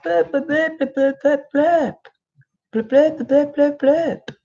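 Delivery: high squeaky blurp babble mocking the ex's ranting; a cartoon of a ranting ex, all noise and no words. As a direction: make his angry messages sound like meaningless high gibberish. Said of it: "he's like".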